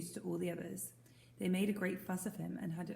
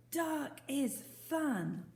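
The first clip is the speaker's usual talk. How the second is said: relishing; 7 semitones higher, much slower than usual. Acted. Boy savouring the dark.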